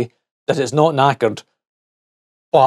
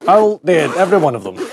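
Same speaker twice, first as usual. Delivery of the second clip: normal talking voice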